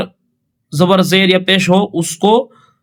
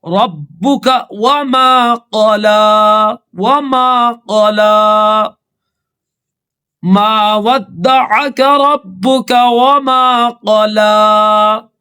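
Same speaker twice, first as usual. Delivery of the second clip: melodic measured tilawat; Quran recitation; 4 semitones higher, much slower than usual. Acted. Teacher recites the surah verses for students to follow. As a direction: chant each verse, stretching the long vowels.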